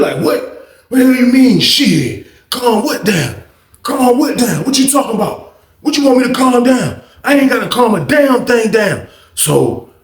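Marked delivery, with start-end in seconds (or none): 0.2-9.1 high-pitched, acted indignant comeback; aggressive indignation. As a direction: fire back as the offended old inmate. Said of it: "Old School was just aggressive and loud".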